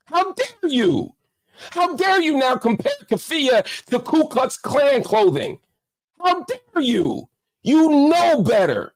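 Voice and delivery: high, slow, stretched out in outrage; scolding a friend who let you down; indignant how dare you. Real, not acted. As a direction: throw the question back at him in disbelief. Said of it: "I probably sound like I'm mad".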